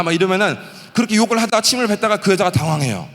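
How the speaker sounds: ordinary talk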